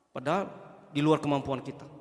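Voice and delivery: ordinary delivery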